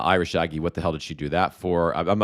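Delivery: ordinary talk, nothing marked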